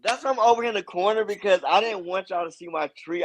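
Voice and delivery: high, laughing and embarrassed. Real, not acted. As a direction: giggle through it, caught out. Embarrassed laughing about tree.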